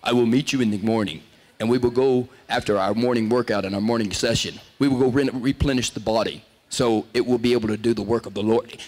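mock the solemn mentor who speaks like a Greek god; imitating Dodd's formal speech. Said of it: "he talks like this".

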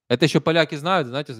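usual speaking voice

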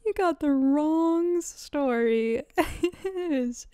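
high, drawn-out, breaking into a laugh; incredulous, slow, tipping into laughter; laughing disbelief. Retold or imitated. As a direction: stretch it out in amused exasperation. Repeats her reply, laughing at the irony.

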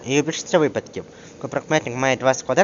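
ordinary talk

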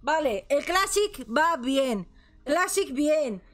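sudden high yelp of relief; excited. Real, not acted. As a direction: blurt it out, finally in. Finally logs into game.

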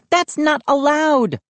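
put-on high, protesting girl's cry; indignant cry. A narrator's reading. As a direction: object at a high pitch. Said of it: "Mindy cried".

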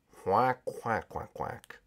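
low, soft imitated quacks; subdued penguin quacking. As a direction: quack quietly, fading away. Penguin answers quietly in quacks.

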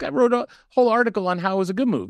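high, fast, indignant retelling of his own protest; exasperated protest. Retold or imitated. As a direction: fire it off high and quick, fed up at being misquoted. Retold defending his article.

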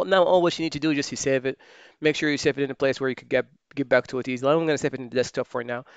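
usual speaking voice

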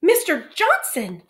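high, loud, slowed cry of shock. A narrator's reading. Teacher startled as the headmaster sways, shocked cry. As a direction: call his name in alarm. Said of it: "said Missus Hunt in shock".

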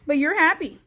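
usual speaking voice

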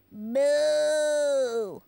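long, drawn-out moo; low like a cow. Shown as a demonstration. Host offers the last quiz sound, long cow moo. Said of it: "Or this?".

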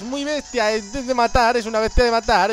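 bubbling excitement; excited hype. Real, not acted. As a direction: pump it up with glee. Hyping his Pokémon.

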